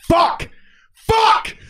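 high, loud cursing outburst; sudden high-pitched shout of dismay; exasperated yell. Real, not acted. Mock dismay at accent remark.

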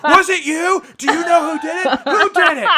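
loud, high shouted interrogation; mock drunken scream. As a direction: imitate a drunk accusing everyone in the aisle. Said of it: "And he starts screaming".

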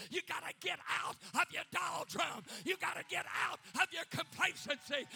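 exhortation rising to a high, full shout; fiery preaching, building. Real, not acted. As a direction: start forceful, climb to roaring conviction.

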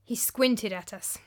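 plain reading voice

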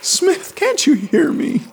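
high, pleading despairing call. A narrator's reading. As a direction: beg with no hope left. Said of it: "he called in despair".

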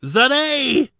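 very high, very loud grieving scream. A narrator's reading. Ladin after the explosion, grieving scream. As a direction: scream her name through tears. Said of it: "he screamed".

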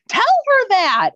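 high, loud and slow: tell her that; high, emphatic mock urging; excited urging. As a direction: urge her on with glee. Doula urging the assistant.

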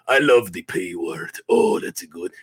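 lightly voiced gloating cousin; a hint of a leering shout; Boris voice. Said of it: "Boris saying".

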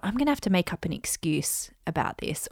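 unvoiced whisper of a private worry; whispered thought. Sick host thinking of faking an excuse, whispered. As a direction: whisper it to yourself, plotting an excuse.

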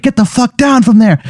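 high-pitched indignant command; mock stern order. Homeowner to a burglar up high, mock stern order.

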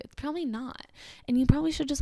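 normal talking voice

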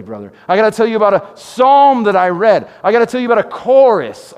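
loud, high, driving preacher cry; preaching at full force. Acted. Pastor rallying the church against grumbling, loud and high. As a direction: build it up like a revival call.

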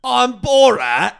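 loud, slow, stretched impression; Borat. Showing friends his best impression, loud and slow. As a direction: announce it big and drawn out. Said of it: "let me try my best impression".

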